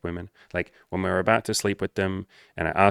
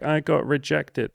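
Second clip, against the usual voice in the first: much higher, slow, mock childish whine; childlike; 7 semitones higher, slower than usual. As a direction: say it like a hurt little kid. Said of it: "innocent, sweet, childlike phrasing".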